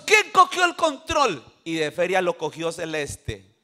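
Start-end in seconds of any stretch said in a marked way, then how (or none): 0.0-1.4 high-pitched acted demand; calling out. Grandfather hunting the lost remote, calling out. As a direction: call out high to the whole house.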